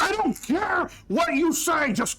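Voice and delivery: high, loud screaming order; furious chef shout. Acted. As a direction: bellow her back to the station. Chef yelling at servant.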